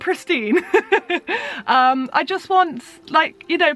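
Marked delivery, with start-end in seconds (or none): 0.7-1.6 high, light giggle; brief amused laugh at one's own remark; short laugh. Real, not acted.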